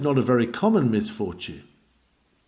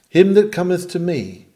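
preaching the text; 4 semitones higher, 5 dB louder than usual. Acted.

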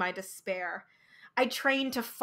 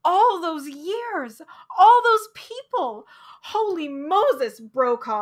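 louder, higher anguished exclamations; crying out; 6 semitones higher, 8 dB louder than usual. Acted. Patient crying out to Brokaw in despair, loud and high. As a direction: cry it out, high and loud, slowing on all those. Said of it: "I cried".

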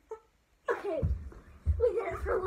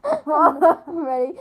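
laughing; 12 dB louder, much slower than usual. Real, not acted. Laughing, stretched out.

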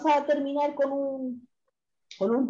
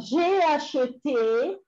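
drawn-out, higher-pitched model phrase; slow dictation; 5 semitones higher, much slower than usual. Shown as a demonstration. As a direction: stretch each syllable for the learners. Repeats dictated verb for students.

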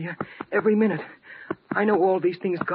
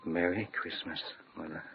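fading wistful whisper; 10 semitones lower, 10 dB quieter than usual. Acted. Memory of mother fading away.

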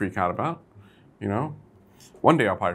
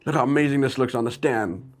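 mock bragging; 6 semitones higher than usual. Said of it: "I'm just messing with you guys".

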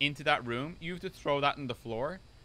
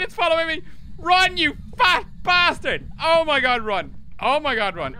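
loud panicked shouting; being chased; 13 semitones higher, 9 dB louder than usual. Real, not acted. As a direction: yell it while running in terror. Fleeing ghost hunt.

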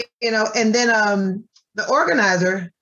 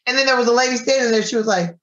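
usual speaking voice

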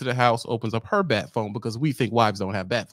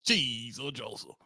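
ordinary talk

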